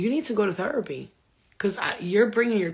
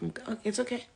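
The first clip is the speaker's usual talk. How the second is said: soft, quiet reassurance; gently calm the child; soothing mom; 3 semitones higher, 7 dB quieter than usual. Retold or imitated. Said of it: "and I'm like".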